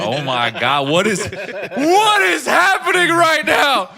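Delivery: slow, high, laughing shout of disbelief; stunned disbelief. Retold or imitated. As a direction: shout it out, cracking up in shock. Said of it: "I was like".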